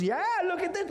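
put-on very high, gleeful little-kid cry; squealing child voice; excited toddler. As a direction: imitate a toddler delighted by a distraction. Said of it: "And he goes".

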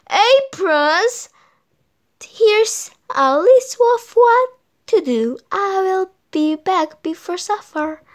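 high, bossy mother hen; brisk hen giving orders; fussy hen voice. A narrator's reading. Missus Hen bossing boys.

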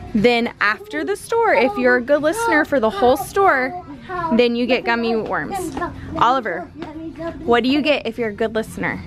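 ordinary talk

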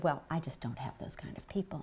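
very soft, low retold murmur; imitating a whisperer. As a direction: drop right down, quoting his confidential excuse. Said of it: "he's whispering".

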